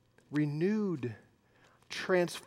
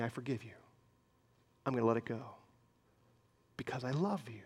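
soft, tender murmur, much lower; tender, hushed; 6 semitones lower, 13 dB quieter, much faster than usual. Pretend. Pastor voicing forgiveness to someone loved, soft and tender. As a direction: say it gently, like letting go. Modeling forgiving words to offender.

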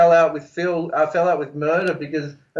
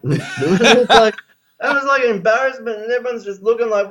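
usual speaking voice

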